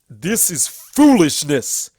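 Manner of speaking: shouting. A narrator's reading. Everfree rejecting the story, shouting. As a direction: thunder out the dismissal. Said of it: "Everfree shouted".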